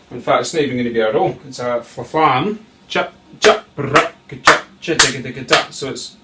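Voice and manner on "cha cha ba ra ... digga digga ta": loud, percussive snare syllables; sharp hits into rolling syllables; vocalised drum rhythm. Shown as a demonstration. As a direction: punch out the drum pattern by mouth. Drum teacher voices the rhythm.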